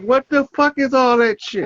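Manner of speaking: hammed-up scolding outburst; mock aggression. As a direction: play the outraged elder, high-pitched. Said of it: "Immediate consequence".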